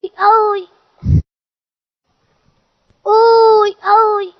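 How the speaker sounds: ui agudo e alto de susto; gritinho assustado. Acted. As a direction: solte gritinhos de espanto. Vê o patrão apanhado, assustado. (gritinhos de susto ao ver o patrão)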